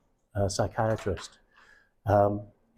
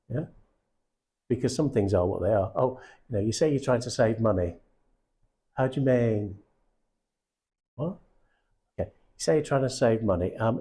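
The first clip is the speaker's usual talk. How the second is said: ordinary talk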